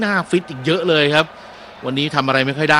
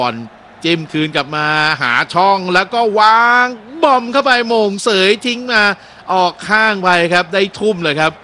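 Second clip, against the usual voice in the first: higher, louder live match call; excited play-by-play; 6 semitones higher, 5 dB louder than usual. Acted. Commentator calls an attack down the left, excited. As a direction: build with every pass.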